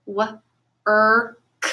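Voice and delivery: "w-, er, k" each sound said separately; sounds stretched apart. Shown as a demonstration. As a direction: split "w-, er, k" into its three sounds. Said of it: "we're gonna stretch it first".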